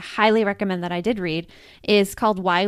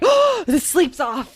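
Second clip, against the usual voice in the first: mock alarm; 7 semitones higher, 5 dB louder, slower than usual. Acts out alarm at lost sleep.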